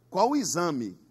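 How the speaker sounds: ordinary talk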